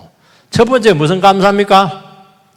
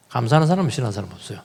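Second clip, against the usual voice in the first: 감사하는 사람을 lower, softer, very fast; 조용히 빠르게 되풀이하듯; quick soft repeat; 7 semitones lower, 6 dB quieter, much faster than usual. Shown as a demonstration. (낮고 빠르게 되뇌기)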